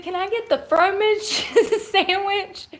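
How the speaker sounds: high, giggling through her own order; cracking up while quoting herself; laughing retelling. Retold or imitated. Retelling her Panera order to viewers, giggling and high. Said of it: "I said".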